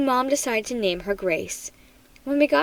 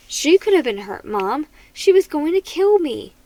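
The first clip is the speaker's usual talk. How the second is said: upset protest; 4 semitones higher, 6 dB louder than usual. Acted. Boy protesting to mother.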